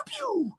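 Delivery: high playful pew like a toy blaster; mock firework pew. Shown as a demonstration. Host suggesting a fireworks sound instead of real ones, high playful pew. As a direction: squeak out a fireworks zap. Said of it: "just go pew".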